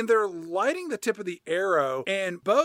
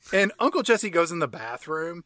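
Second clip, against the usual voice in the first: ordinary talk, nothing marked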